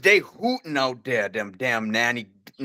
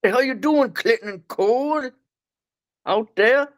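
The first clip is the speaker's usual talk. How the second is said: grandpa holler; 9 semitones higher than usual. Retold or imitated. Grandpa calling Clinton and Cole outside. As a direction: yell both names out the door. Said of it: "you gotta emphasize".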